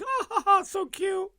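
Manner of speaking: very high mock old-lady titter; imitated falsetto old-lady fawning; old-lady cooing. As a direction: coo like a delighted old lady. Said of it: "old ladies that shop at T J Maxx are like".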